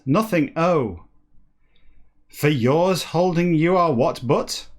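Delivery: usual speaking voice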